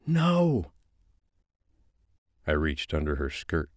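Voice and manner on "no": high, sharp female no; high woman's voice, defiant. Acted. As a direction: snap a denial back. Female character denies, voiced high.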